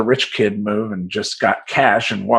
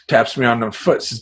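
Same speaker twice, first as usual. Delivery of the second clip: ordinary talk, nothing marked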